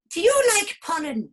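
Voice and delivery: squeaky flower question; high flower voice. Acted. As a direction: ask like an eager little flower.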